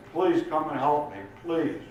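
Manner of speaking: acted plea for help, high-pitched; pleading drunk caller. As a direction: beg the police to come. Drunk caller begs police for help.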